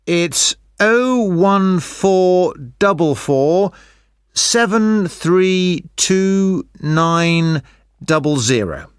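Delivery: slow, clear digit by digit; slow dictation. Acted. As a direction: spell the number out slowly. Dictating a phone number.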